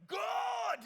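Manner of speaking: high, loud, drawn-out yell; exasperated shout. Retold or imitated. Driver reacting to mom's split window, exasperated shout. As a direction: shout it to the heavens, stretched out. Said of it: "I'm like".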